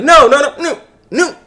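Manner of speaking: emphatic denial. Retold or imitated. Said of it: "Gato's like".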